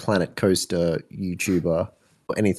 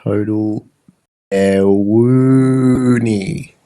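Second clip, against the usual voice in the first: drawn-out baloney; 7 dB louder, much slower than usual. Pretend. Echoing baloney woo, drawn out.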